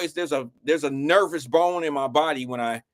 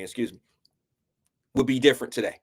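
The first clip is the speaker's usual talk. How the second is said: ordinary talk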